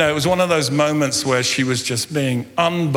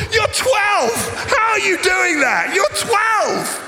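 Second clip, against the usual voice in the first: incredulous shout; slower than usual. Real, not acted. Pastor to congregation, squealing in disbelief at his daughter. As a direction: shout it up high, outraged and amused. Exasperated outburst about daughter.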